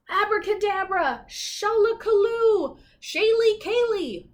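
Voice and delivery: high, loud, slow spell chant; witch croaking spell. Acted. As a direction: cast it like a cackling witch. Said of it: "croaked the old woman".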